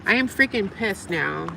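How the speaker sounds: usual speaking voice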